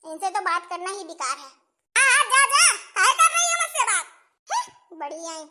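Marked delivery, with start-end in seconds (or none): 1.9-5.4 angry dismissal. Acted. Mother-in-law angrily tells her daughter-in-law to go. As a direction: go on, get lost, shouted.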